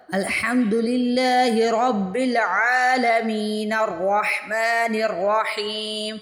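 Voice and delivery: slow chant linking العالمین into الرحمن without a breath; recited joined together. Shown as a demonstration. Teacher demonstrates connecting two verses, slow chant without pause. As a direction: carry العالمین straight on into الرحمن. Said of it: "وصل کیا، connect کر دیا دونوں آیات کو".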